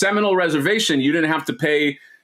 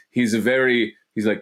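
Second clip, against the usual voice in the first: ordinary delivery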